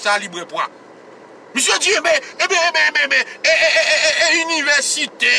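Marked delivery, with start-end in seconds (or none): 1.5-5.3 high, loud mocking stammer. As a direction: ham up their fumbling eh ben. Mocks the men stammering. (imite mesye yo k ap bege, vwa wo)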